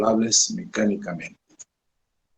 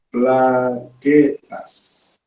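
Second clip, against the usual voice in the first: "Plaquetas" stretched out very slowly with a rising end; drawn-out dictation; much slower than usual. Shown as a demonstration. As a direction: draw the word out for students to write down. ("Plaquetas" muy alargada, lenta y subiendo)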